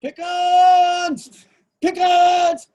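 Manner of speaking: high, loud furious call; shouting a name. Retold or imitated. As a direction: bellow the name from the woods. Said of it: "my name going".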